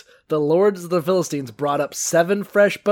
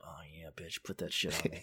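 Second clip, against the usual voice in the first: very low, barely audible murmur; hushed, hammed-up bedroom reply; mock bedroom whisper; 11 semitones lower, 17 dB quieter, faster than usual. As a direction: whisper it, playing turned on. Samson answering in acted bedroom scene.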